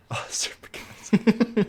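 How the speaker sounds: whispered, slowed-down echo of the name; mock whisper. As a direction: breathe the name back as a teasing whisper. Mocking the mispronounced name.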